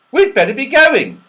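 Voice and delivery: very high, loud rabbit voice. Acted. Rabbits taking leave of the children, very high and loud. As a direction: squeak out a polite goodbye. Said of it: "they said".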